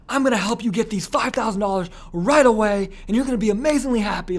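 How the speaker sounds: high-pitched, emphatic sales promise; absolute certainty. Shown as a demonstration. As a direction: promise it with over-the-top conviction. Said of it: "That's absolute certainty".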